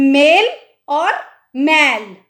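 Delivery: मेल with a short e, मैल with an open ai, said slowly; e versus ai vowel. Shown as a demonstration. Teacher contrasting मेल and मैल for pupils. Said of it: "मेल मतलब एक ए आएगा और मैल का".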